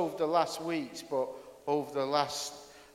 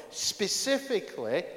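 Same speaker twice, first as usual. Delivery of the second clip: ordinary delivery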